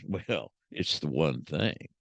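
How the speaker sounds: a grizzled cowboy dropping his voice deep and slow; Jack Palance cowboy. Retold or imitated. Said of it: "Jack Palance looks at him and says".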